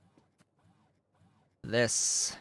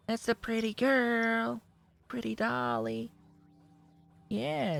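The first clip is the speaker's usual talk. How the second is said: much higher, cooing pet voice; 7 semitones higher, 4 dB louder than usual. Real, not acted. Talking to his cat Dolly on his lap, high cooing baby talk.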